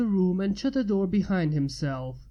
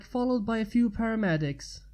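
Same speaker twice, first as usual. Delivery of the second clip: ordinary delivery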